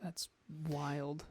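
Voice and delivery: quiet, deadpan sarcasm; sarcastic. Real, not acted. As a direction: softly feign being impressed, sarcastically. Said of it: "I said that sarcastically".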